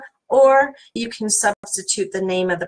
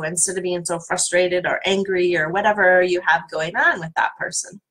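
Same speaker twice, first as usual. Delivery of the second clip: normal talking voice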